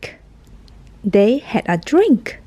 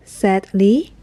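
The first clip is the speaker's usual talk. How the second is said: usual speaking voice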